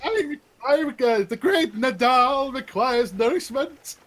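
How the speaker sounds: high, loud, grandiose proclamation; great Nadal. Acted. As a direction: announce yourself like a pompous showman. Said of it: "I'm keeping in character now".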